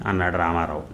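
usual speaking voice

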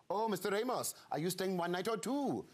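put-on high, lilting Welsh accent; Welsh receptionist. Welsh receptionist asking a guest about his booking, high Welsh accent. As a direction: imitate a Welsh hotel receptionist's singsong question. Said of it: "checked into a hotel in Wales, and the receptionist said".

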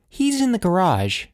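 high, lightly voiced child; uneasy young son. A narrator's reading. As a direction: answer as an uneasy little boy. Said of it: "uneasy tone in her son's voice".

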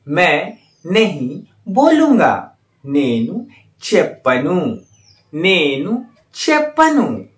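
slow, clear model sentence for learners; slow teacher's model reading; slow and careful. Shown as a demonstration. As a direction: spell it out slowly so they can repeat. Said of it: "आप उसे ध्यान से सुनिएगा और उसे अच्छी तरह से अभ्यास कीजिएगा".

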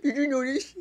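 high squeaky voice with mouth full; squirrel voice. Acted. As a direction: squeak it like a chipmunk with cheeks stuffed. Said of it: "just imagine she's a squirrel".

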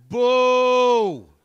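heckling his own audience with a boo; loud boo. Real, not acted. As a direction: boo the crowd hard. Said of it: "I do it every night".

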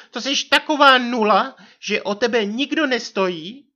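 high, loud scornful wife voice; pohrdavá manželka. A narrator's reading. Wife belittles narrator in quarrel. (pohrdavý vysoký hlas manželky)

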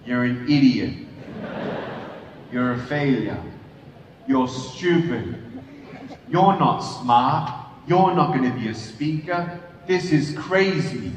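a lightly voiced cruel voice in his head; inner critic voice. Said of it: "hearing those voices in my head".

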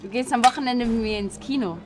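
usual speaking voice

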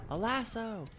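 high mock yell of the answer; girlfriend yelling. Girlfriend shouting the answer across the room, mock yell. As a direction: blurt it out like the obvious solution. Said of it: "my girlfriend yells".

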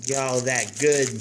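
normal talking voice

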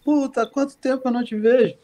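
put-on jokey swear between old friends, no offense meant; friendly mock insult. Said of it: "Dois amigos se encontram".

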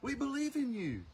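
high mock-eager believers' voice; mocking crowd voice. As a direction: mimic the crowd gushing their faith. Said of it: "They're like".

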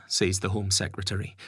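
ordinary delivery

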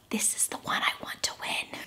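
hushed, secretive whisper; barely-there stage whisper; confiding whisper. Real, not acted. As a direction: whisper it like a secret between you and the viewers. Confides her favourite pick to viewers.

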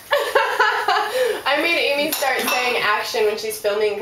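talking through laughter; laughing. Real, not acted. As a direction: laugh while you tell it. Laughing while telling story.

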